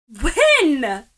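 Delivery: loud, clipped, cutting one-word shot; scathing retort. A narrator's reading. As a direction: fire it back with scorn. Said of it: "shoots scathingly".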